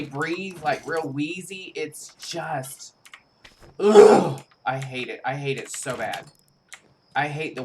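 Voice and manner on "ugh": loud revolted ugh; disgusted groan. Real, not acted. Disgust at Wendigo sight.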